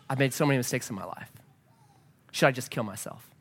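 low, soft, understated despair; quiet despair. Retold or imitated. Pastor voicing the woman looking at him, quiet imitated despair. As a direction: ask it hushed and defeated.

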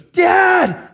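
high, loud indignant shout; mock outraged daughter yelling for help; enraged. Daughter storming up to father, indignant yell. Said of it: "She's just enraged and indignant".

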